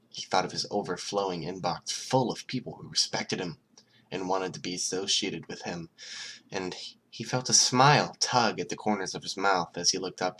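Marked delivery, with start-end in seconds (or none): none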